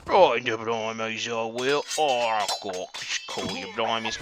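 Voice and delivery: lightly held mock Queen's English, back at normal pitch; a hint of the Cockney put-on, winding down; mock Queen's English accent. Shown as a demonstration. Gamer finishing his joke in a fading mock Queen's English. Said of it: "say that with the proper accent".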